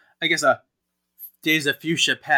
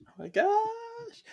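much higher, slow, thrilled squeal; excited squeal; 4 dB quieter, slower than usual. Real, not acted. Host squealing with delight at the reveal. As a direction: let it rise into a delighted squeal. Reacting to the figure appearing.

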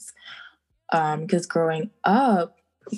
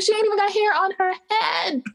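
incredulous retort; 13 semitones higher than usual. Retold or imitated. Incredulous retort, much higher.

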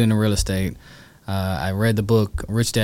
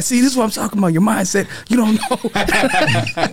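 much higher and louder, lecturing while laughing; laughing mock rant; 12 semitones higher, 4 dB louder than usual. Son lecturing his dad about his mindset, laughing rant. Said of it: "So I'm pissed at him".

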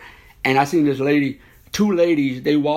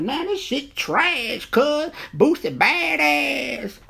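[name] voice; 9 semitones higher than usual. Retold or imitated. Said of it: "let me do my [name] voice".

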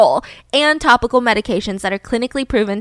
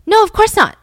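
higher, louder hasty denial; too-quick denial; 10 semitones higher, 7 dB louder than usual. Retold or imitated. Candy lying to Betty about the affair, too-quick denial. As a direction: blurt it out as an obvious lie. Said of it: "Candy said it was too quick".

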